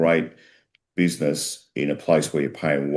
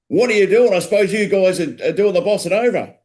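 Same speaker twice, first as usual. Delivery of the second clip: high, loud mock outrage; hammed-up angry shouting, pitched way up; angry cop; 5 dB louder than usual. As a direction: burst out as a fed-up policeman confronting culprits. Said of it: "he's angry".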